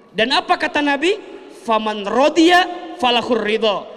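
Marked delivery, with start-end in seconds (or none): 1.6-3.9 loud Arabic recitation; loud preacher reciting the Prophet's words; recited hadith, declaimed. Real, not acted. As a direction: proclaim the hadith with full force. Quoting the Prophet.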